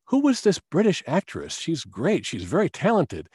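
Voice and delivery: re-enacted enthusiastic praise; retold admiration. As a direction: act out being very impressed, pitch well up. Retelling own admiring question to client.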